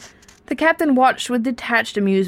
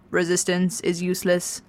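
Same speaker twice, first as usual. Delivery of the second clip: ordinary delivery, nothing marked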